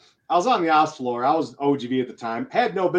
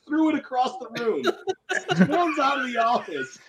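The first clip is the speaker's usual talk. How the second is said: ordinary talk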